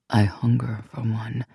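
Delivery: intimate unvoiced whisper. A narrator's reading. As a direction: breathe it close to her ear.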